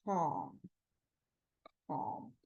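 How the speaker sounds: low, soft and slow; hushed soothing demo; calm, softly. Shown as a demonstration. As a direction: say it as gently as the sign.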